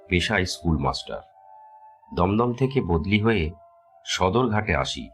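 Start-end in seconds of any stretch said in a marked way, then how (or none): none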